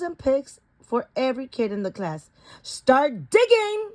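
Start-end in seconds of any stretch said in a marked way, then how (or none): 2.6-3.9 high, slow yelled command; teacher yelling an order. A narrator's reading. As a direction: call it out to the whole class. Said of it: "yelled Miss Frizzle".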